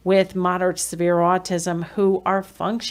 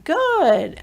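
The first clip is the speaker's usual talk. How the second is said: bright praise; 9 semitones higher, 3 dB louder than usual. Shown as a demonstration.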